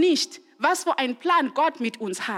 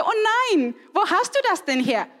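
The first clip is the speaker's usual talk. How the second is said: startled mom; 5 semitones higher than usual. Retold or imitated. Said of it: "manchmal bin ich total erschrocken".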